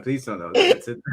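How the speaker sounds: ordinary delivery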